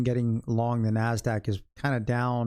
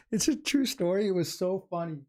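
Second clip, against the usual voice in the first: laughing while talking; 8 semitones higher than usual. Real, not acted. Still laughing after the story.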